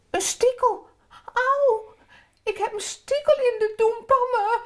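high-pitched little kabouter yelping about a splinter; child yelling in pain. Acted. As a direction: yelp it out in a high child's voice, a bit hurried. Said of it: "schreeuwt Pim dan nog van".

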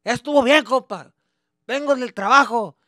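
loud, high yelling dad in Spanish; angry Mexican dad. Retold or imitated. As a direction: shout down the dancing kid after work. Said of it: "my dad would just be".

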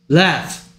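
"Left" as one clear, isolated word ending in an f sound; pronunciation model. Shown as a demonstration. Teacher correcting a student's word, clear model of "Left" with final f. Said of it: "다시 읽어보세요".

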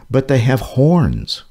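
ordinary delivery

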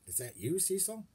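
rising high on Cecil in wonder; amazed. A narrator's reading. Miss Frizzle meeting penguin Cecil, amazed. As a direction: sound astonished at the penguin. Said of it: "asked in amazement".

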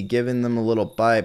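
ordinary talk

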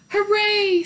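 high joyful cheer; hooray. A narrator's reading. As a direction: cheer with relief. Baby relieved.

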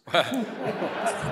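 a big burst of real laughter, high and loud. Real, not acted. Audience member laughing loudly at his own joke.

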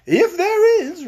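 high, loud, drawn-out reply; comic king. Acted. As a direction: answer grandly after the song. Voices Rinkitink's reply.

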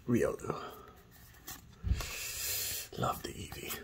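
breathy whisper to himself; whispered aside. Real, not acted. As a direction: whisper it like a secret. Murmured aside while flipping cards.

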